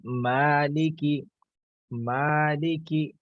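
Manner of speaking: "मालिकी" very slow, the first a stretched long; long vowel. Shown as a demonstration. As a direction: draw out the a in "मालिकी" slowly. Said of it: "तो कैसे पढ़ेंगे?". ("मालिकी" में आ को लंबा खींचकर धीरे)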